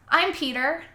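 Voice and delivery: put-on boy speaking up. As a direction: pipe up as a brave little boy. Brave boy volunteers himself to officer.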